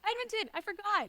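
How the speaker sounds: high, loud burst of delight; thrilled recognition, voice shooting up; squealing with joy at the news; excited. Real, not acted. Excited reaction to shared hometown.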